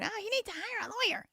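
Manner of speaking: high, fast, mocking squeal. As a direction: parrot them in a silly high voice. Mocking people freaking out.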